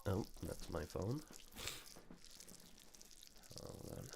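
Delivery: ordinary delivery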